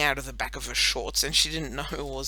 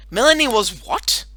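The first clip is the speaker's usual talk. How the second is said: surprised; 6 semitones higher, 11 dB louder than usual. Acted. Atticus interrupts, surprised.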